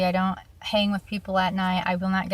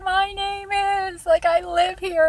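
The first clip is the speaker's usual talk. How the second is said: put-on entitled girlfriend; 11 semitones higher, slower than usual. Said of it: "she's like".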